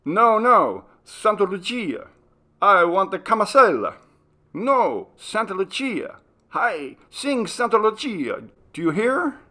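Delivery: high, loud insistent foreign voice; squabbling tourist. A narrator's reading. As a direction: argue over the song, insisting. Tourists squabble over song.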